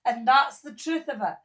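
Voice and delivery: usual speaking voice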